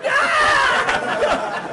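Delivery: high shouted "Ah!" of fake shock; loud mock gasp yell. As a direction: yelp it high like a showman.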